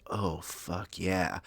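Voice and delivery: soft, drawn-out mock moan; slow, hushed enjoyment; mock pleasure. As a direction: savour it slowly and quietly, playfully suggestive. Mushroom enjoys being tugged.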